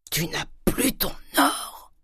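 slow, high, strained cry of disbelief; aghast cry. A narrator's reading. Father learns his daughter's gold is gone, aghast. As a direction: cry it out drawn-out, voice straining upward. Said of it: "s'écria Grandet".

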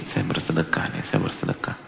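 soft, murmured reflection; quiet inner thought. Retold or imitated. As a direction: say it softly to yourself. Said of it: "Dan resapi". (bisikan hati sambil meresapi sedekah)

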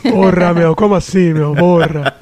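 loud, low mock Italian-Paulista accent; Paulistano Italian accent. Teased paulista proving the imitation, mock-indignant Italian-Paulista accent. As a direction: ham up the São Paulo Italian twang. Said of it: "Italiano vocês imitam bem".

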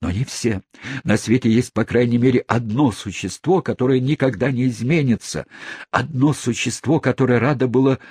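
high, impassioned declaration; ardent. Acted. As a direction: declare love with fervour. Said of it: "пылкий мистер Тапман".

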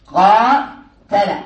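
very slow قاتل with every letter stretched; drawn out for attention. Shown as a demonstration. Teacher making students hear the verb's letters, very slow. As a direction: pull قاتل apart slowly so the alif is heard. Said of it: "انتبه معي".

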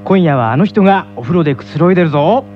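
usual speaking voice

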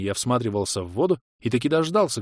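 ordinary delivery

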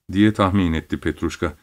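plain reading voice

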